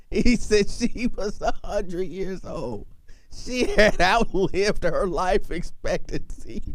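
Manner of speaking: slow, breathy words half lost to laughter; whisper-laughing. Real, not acted. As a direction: try to say it while cracking up. Retelling joke, stifling laughter.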